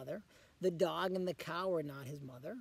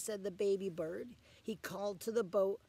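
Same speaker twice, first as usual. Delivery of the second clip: ordinary delivery, nothing marked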